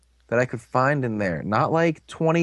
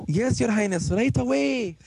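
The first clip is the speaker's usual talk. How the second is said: high, fawning servant voice; servile doorman; 10 semitones higher than usual. Retold or imitated. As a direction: grovel like a lackey.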